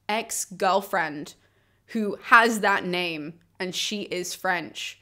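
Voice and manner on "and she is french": ordinary talk, nothing marked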